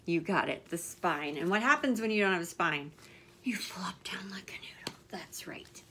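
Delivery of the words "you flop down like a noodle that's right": playful whispered answer. Real, not acted. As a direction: whisper it like a fun secret.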